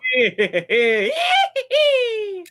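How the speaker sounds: loud, high cackling laugh; hammed-up evil cackle; villain laugh. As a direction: cackle over the victim.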